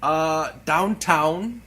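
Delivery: very slow, put-on British accent; mock English accent. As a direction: drag it out in an improvised English accent. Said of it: "if I had done the English dialect".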